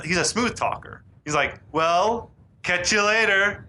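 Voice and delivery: usual speaking voice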